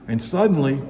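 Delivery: ordinary delivery, nothing marked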